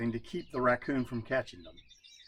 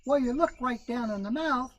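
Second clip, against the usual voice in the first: Brer Rabbit voice; 11 semitones higher, 4 dB louder than usual. A narrator's reading. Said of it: "said the rabbit".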